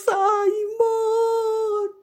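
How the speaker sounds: very slow, high sobbing; grieving grandfather. A narrator's reading. Father mourning his grandson, very slow high sob. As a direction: stretch each word in grief. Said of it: "y el padre".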